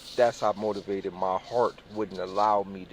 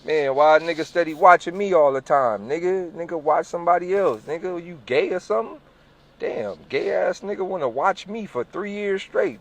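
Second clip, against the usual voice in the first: higher, louder mock outrage; 7 semitones higher, 5 dB louder than usual. Snapping at the security guard, mock offended outrage. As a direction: act offended at being watched. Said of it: "You start getting offensive".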